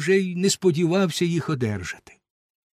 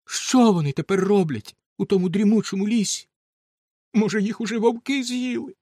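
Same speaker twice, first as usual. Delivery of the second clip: higher, grieving mother's plea; lamenting mother; 6 semitones higher, 3 dB louder than usual. Acted. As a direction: reproach the husband through grief. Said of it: "Жінка зітхнула та й каже". (жалібний голос матері)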